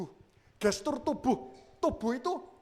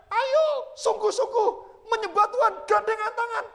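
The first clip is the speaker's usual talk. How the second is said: high, loud exhortation; pitched high and loud, pressing them on; insistent worship leader; 13 semitones higher, 8 dB louder than usual. Retold or imitated. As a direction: mimic a worship leader urging the crowd. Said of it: "W L-nya tetap konsisten".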